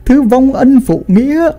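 put-on old father disowning his son, pitch soaring by the end; angry denunciation. A narrator's reading. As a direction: narrator acting a wrathful banishment, building to a high cry. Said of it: "chỉ tay vào thằng con trai mình mà nói".

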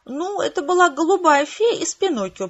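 high purring cat voice. A narrator's reading. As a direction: purr it smugly. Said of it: "промурлыкал кот".

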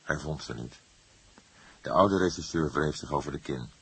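ordinary delivery, nothing marked

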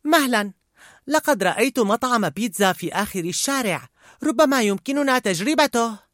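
put-on high, eager child character; Riley. A narrator's reading. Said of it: "قالت رايلي لوالدتها".

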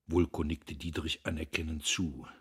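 plain reading voice

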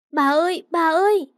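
put-on loud, high child's call; frightened calling out; alarmed cry. A narrator's reading. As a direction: cry out in panic for grandma. Child runs to collapsing grandmother.

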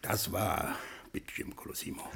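hushed, voiceless whisper. Acted. Gangster boss confides the threat to his butler, whispering. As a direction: keep it secret from the guards, whispered.

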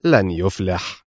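understated triumph, slow and low; triumphant. A narrator's reading. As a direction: savour the victory slowly. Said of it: "قائلا في لهجة ظافرة".

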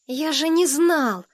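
put-on high, drawn-out childish protest; protesting little boy. A narrator's reading. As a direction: defend yourself like a small boy. Boy defends himself to older boys.

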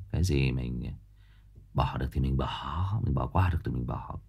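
soft letting go. Real, not acted. Letting things go, calm contrast. (nói nhỏ nhẹ, buông bỏ)

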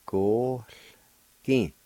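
"Go, kin" as two clear separate syllables. Shown as a demonstration. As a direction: model "Go, kin" slowly for learners. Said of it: "like this".

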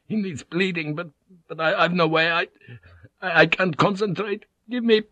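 high, faltering, panicky stammer; breaking down. A narrator's reading. Exhausted Vink failing to think of treatment, breaking down. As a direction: stammer helplessly, pitch climbing. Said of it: "He stopped exhaustedly".

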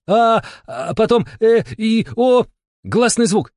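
high, slow stretched vowels; excited drawn-out intoning. A narrator's reading. Shama carried away by the game, excitedly intoning. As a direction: chant out each vowel, loud and high. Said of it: "От азарта шаманка ... и затянула".

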